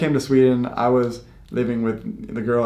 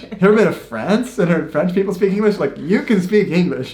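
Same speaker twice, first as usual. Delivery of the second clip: higher, louder, fast rhetorical questions to friends; retelling how he chides friends who doubt their English, louder and higher; emphatic mock scolding; 7 semitones higher, 6 dB louder than usual. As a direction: push it, teasing disbelief. Chiding self-conscious friends, retold.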